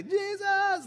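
high-pitched mock cry of panic; panicked cry. Disciples waking Jesus as waves overtake the boat, acted panicked cry. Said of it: "the disciples are panicking".

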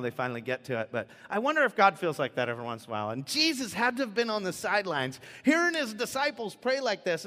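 ordinary talk

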